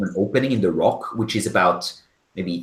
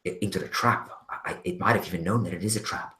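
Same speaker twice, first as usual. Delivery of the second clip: soft, hushed and shaken; shaken whisper; 5 dB quieter than usual. Acted. Valky tells Urda of the killings, hushed and shaken. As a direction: whisper the horror you just saw. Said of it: "she says".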